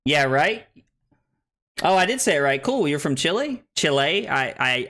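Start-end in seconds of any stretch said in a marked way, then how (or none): 3.2-3.6 Chile said like "chilly"; questioning Chile, rhyming with "silly"; anglicized Chile. Real, not acted. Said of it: "I don't think it's just chili". 3.8-4.3 Chile said "CHEE-lay"; tentative Chile with an "ay" ending; corrected pronunciation. Real, not acted. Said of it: "I think it's actually pronounced Chile".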